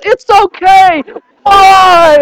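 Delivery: high loud forced calm breaking into why; strained reassurance. Retold or imitated. Dad to crying son amid flood, high strained shout. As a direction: try to soothe but shout anyway.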